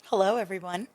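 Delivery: soft radio-host hello. Real, not acted. Guest greeting the podcast listeners in a radio voice. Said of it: "Is that your radio voice".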